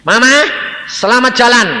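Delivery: loud, high farewell call. Retold or imitated. Son bidding his dead mother farewell, calling out. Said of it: "tak umpanggil".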